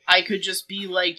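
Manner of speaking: ordinary talk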